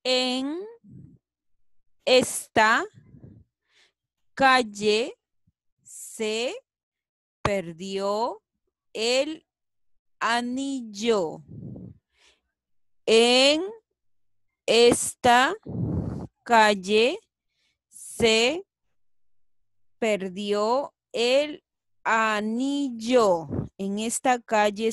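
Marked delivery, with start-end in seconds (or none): 0.0-11.4 slow, separated words. Shown as a demonstration. Teacher reads the sentence word by word for pupils. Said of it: "A ver". 13.1-23.5 loud, slow, separated words; word by word, louder. Shown as a demonstration. Teacher rereads the sentence word by word, louder. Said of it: "A ver".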